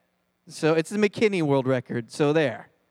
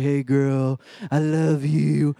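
low, slow mock crooner; lightly voiced teenage boy, lower and slower; boy's pickup line; 6 semitones lower, slower than usual. Boy trying to get a girl alone, low slow mock romance. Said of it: "he's like".

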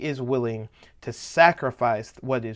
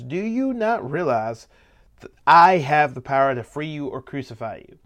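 flex your power, drawing out the question; 5 dB louder than usual. Acted. Pilate asserting his power over Jesus, flexing. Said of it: "Pilate flexes".

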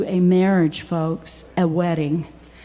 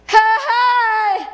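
high, loud holler calling out; 12 semitones higher, 4 dB louder than usual. Shown as a demonstration. Preacher showing what hear means, hollering. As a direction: holler it across a field. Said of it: "It means to holler".

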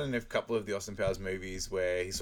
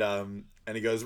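usual speaking voice

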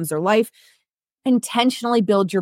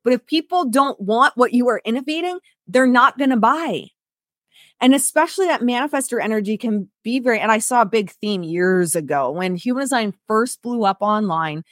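usual speaking voice

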